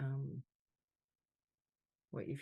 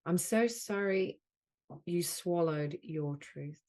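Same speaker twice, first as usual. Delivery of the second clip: deliberate phrases, louder than the chat, long silences between; measured delivery, leaning on "so sorry"; guided healing affirmation; 7 dB louder, slower than usual. Acted. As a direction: offer each apology as a healing phrase. Leads healing phrases with long pauses.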